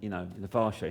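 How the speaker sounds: usual speaking voice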